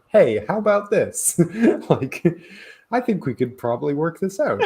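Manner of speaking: mock-friendly hero offering a deal; sweet mock hero voice. Heroes mockingly voiced.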